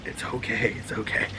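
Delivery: soft, hushed reassurance; calm the nervous dog down; soothing. Real, not acted. Owner softly calming his dog during the recording. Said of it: "soothing the dog".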